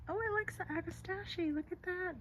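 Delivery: high, hushed excitement; delighted squeal. Real, not acted. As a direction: coo at the bird as it feeds. Delighted hummingbird at agastache.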